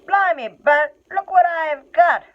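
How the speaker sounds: high, loud rough troll; a troll crowing over his catch; loud, high troll voice calling to his mate. Acted. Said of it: "said William".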